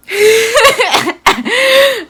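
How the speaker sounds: high, loud burst of disbelieving laughter. Real, not acted. Daughter laughing out loud at the clerk, high and loud.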